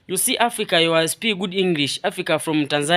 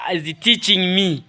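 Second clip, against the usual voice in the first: ordinary delivery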